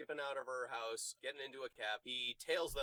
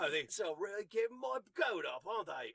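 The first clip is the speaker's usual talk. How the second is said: mock Cockney; 6 semitones higher than usual. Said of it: "Cockney guy".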